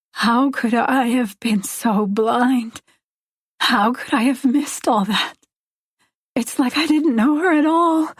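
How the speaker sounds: distressed but short of yelling; anguished self-reproach. A narrator's reading. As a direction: ask it in pained disbelief, pitch climbing. Woman blames herself, near tears.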